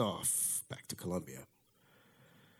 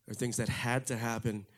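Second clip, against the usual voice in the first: usual speaking voice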